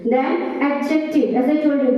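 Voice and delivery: ordinary delivery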